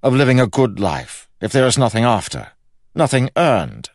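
high, loud, exasperated complaint; grumbling king. A narrator's reading. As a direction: protest as a frustrated king. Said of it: "grumbled the king".

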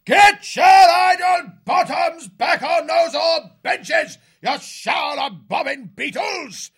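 high, loud bullying bellow; shouting officer. A narrator's reading. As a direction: roar the rowing orders over the clamour. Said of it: "Cuthbert's shouts rang out above the clamour".

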